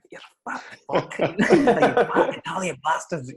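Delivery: laughing imitation of a Scottish coach's rant, high; mock Scottish manager ranting through laughter; Scottish accent. Coach George Mackey insulting the Italian lads, mocked in Scottish accent. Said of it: "his Scottish accent".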